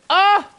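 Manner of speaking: sharp high yelp of pain. Real, not acted. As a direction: yelp out as if burned. Reacting to heat in game.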